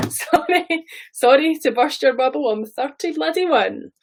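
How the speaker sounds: normal talking voice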